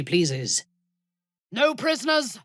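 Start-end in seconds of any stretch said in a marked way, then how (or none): 1.5-2.4 battle cry. Acted. Warrior rallies the party with a high battle cry. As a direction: call it out as you charge.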